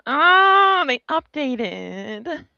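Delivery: high, slow whiny moan; kvetching.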